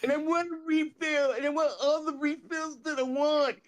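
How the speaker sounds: high put-on whine; Roger impression. Homeless man wanting soda refills, high whining impression. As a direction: demand it in a high, plaintive voice. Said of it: "Roger talks like this".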